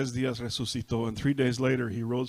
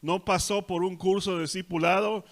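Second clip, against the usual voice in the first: raised, louder preaching cadence; forceful preaching; 5 semitones higher, 5 dB louder than usual. Acted. Preacher lists what the thief never did, forcefully. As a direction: drive it home to the congregation.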